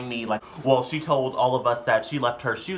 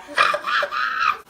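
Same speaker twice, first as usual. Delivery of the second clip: high, loud laughing; 5 dB louder than usual. Real, not acted. Laughing at their own bit, loud.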